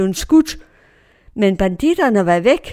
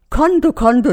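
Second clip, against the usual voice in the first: louder, higher mock cry of alarm; retold scream; 5 semitones higher, 6 dB louder than usual. Passengers cry out at armed robbers, retold scream. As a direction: cry out as if warning of bandits. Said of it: "Og vi skreg".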